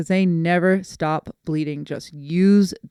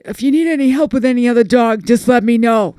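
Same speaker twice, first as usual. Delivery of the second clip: light cousin impression, louder and higher; Franny; 5 semitones higher, 8 dB louder than usual. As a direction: imitate your cousin offering help, voice lifted. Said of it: "That's how she talks".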